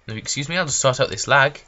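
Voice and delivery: usual speaking voice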